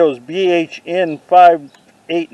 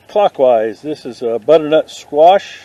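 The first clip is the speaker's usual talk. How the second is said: usual speaking voice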